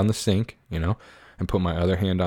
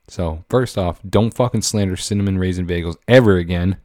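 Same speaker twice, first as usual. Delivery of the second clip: normal talking voice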